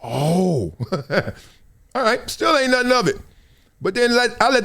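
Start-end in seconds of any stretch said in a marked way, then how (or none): none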